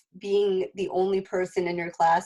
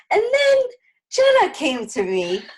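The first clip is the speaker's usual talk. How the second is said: bursting with joy, much louder and higher; excited; 12 semitones higher, 6 dB louder than usual. Real, not acted. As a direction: announce it like a happy reveal. Excited payoff of story.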